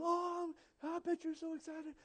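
high, soft, sentimental gushing; mock gushing well-wishers. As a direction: coo over the couple like teary church ladies. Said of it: "people crying".

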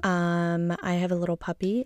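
usual speaking voice

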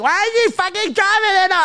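put-on high indignant girl voice; mock angry girl. Angry passenger accusing the driver, high mock indignation. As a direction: whine it up high, offended.

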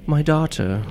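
fuller "My daughter", falling; mother's voice. Acted. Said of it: "It's kinda like that".

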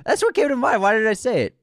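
high, loud self-reproach; worked-up, high-pitched exclaiming; exasperated outburst. Real, not acted. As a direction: kick yourself loudly over a near miss. Kicks himself over missed answer.

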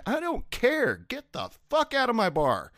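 yelled order, pitch way up; high retold yell. Bartender kicking out an entitled regular, high yell. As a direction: throw him out of the bar, high and sharp.

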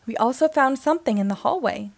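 ordinary delivery, nothing marked

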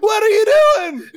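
high, loud, indignant shout; outraged yell. Acted. Attacked Jerry to the one on him, outraged yell.

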